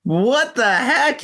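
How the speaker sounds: high, drawn-out disbelief; incredulous outburst. Retold or imitated. Telling a wild breakup story, incredulous. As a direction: stretch it out in high-pitched mock outrage. Retells an outraged reaction to girlfriend.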